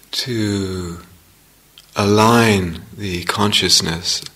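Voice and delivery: unhurried meditation guidance with long pauses; guided meditation. Real, not acted. Teacher settling meditators into the felt sense of the body, guided meditation. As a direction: ease them gently into practice, leaving space between phrases.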